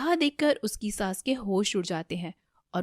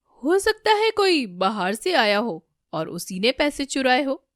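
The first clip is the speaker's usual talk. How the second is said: louder, anxious suggestion; nervous; 5 semitones higher, 6 dB louder than usual. A narrator's reading. Damini deflecting blame onto an outsider, nervous. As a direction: offer an uneasy theory, raised voice. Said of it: "Damini काफी घबराते हुए".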